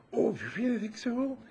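high-pitched, delighted disbelief; coyly surprised by a compliment; flattered surprise. Retold or imitated. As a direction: imitate a flattered man, voice way up. Acts the flattered Frenchman's reply.

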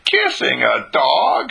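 drawn-out disbelief at the very idea; amazed exclamation. A narrator's reading. Telling how Ellen kisses the dog, amazed exclamation.

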